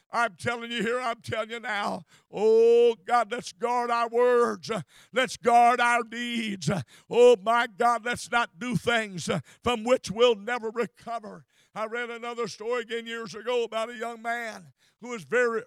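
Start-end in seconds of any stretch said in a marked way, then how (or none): 0.1-11.4 loud, slow, hammered preaching; preaching at full force. Acted. Preacher exhorting the church to guard their words, loud and slow. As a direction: drive each word home to the congregation.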